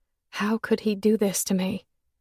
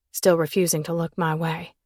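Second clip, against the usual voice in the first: plain reading voice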